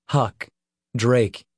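plain reading voice

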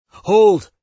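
sharp loud cry of "Hold!"; shout. Acted. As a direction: bark out a command to stop. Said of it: "Dale cried out".

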